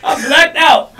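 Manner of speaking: loud burst, laughing at the pain; shouted retelling. Retold or imitated. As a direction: blurt it out loud.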